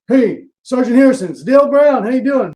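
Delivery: booming friendly phone hello; Dale's phone greeting. Retold or imitated. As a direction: play the coach calling up, hearty and loud. Dale on the phone, performed.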